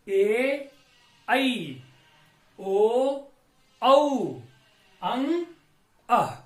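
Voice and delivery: each vowel drawn out alone; reciting vowels. Shown as a demonstration. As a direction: sound out every Hindi vowel slowly and separately. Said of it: "सबसे पहले आता है अ".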